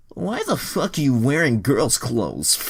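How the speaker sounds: loud, high, slow put-on voice; demanding question. Kieran confronts disguised Troy, loud put-on voice. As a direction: demand it, stretched out. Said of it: "Kieran asked".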